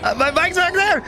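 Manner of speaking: high, panicked shouting with laughs; frantic laughing yells; being chased. Real, not acted. Bike chases and runs them over.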